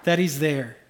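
earnest declaration. Retold or imitated. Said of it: "He's like".

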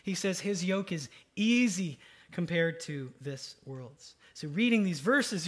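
ordinary delivery

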